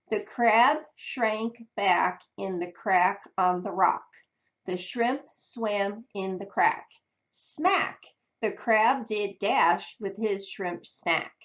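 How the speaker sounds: slow, choppy decodable-text reading; reading a phonics reader. Shown as a demonstration. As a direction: read it out slowly to show how odd it sounds. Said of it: "I just want you to hear kinda how crazy it sounds".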